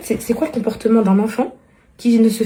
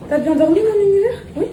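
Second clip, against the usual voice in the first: higher and louder, loving greeting; tender coo; 8 semitones higher, 4 dB louder than usual. Real, not acted. Réveil tendre de l'enfant. (accueil tendre et aigu)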